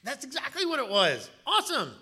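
high, loud burst of enthusiasm; over-the-top thrilled approval; acted delight. Acted delighted reply to recruit.

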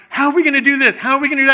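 loud, high mock panic; acted anxious outburst, pitched high and loud; freaking-out wife. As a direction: fret at full volume like a panicking wife. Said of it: "she's freaking out the whole time".

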